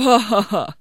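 loud, acted ha-ha-ha; triumphant story-character laugh; gloating laugh. A narrator's reading.

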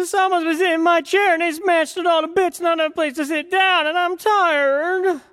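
put-on very high, loud little baby bear; a tiny bear whining that it's all gone; squeaky-high wee bear complaining. A narrator's reading. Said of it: "He said".

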